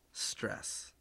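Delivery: breathy whispered reveal; dramatic whisper. Acted. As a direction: whisper the word like a secret. Dramatic one-word reveal.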